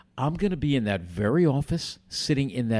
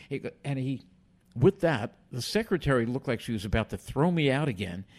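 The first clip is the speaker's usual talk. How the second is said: ordinary talk, nothing marked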